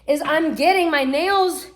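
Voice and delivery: ordinary talk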